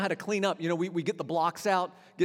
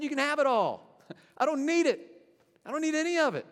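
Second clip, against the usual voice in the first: higher, louder with a laugh, dismissive; Moses renouncing; 7 semitones higher, 4 dB louder than usual. Retold or imitated. Moses giving up everything, laughing and dismissive. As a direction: toss it all away with a laugh. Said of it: "Moses said".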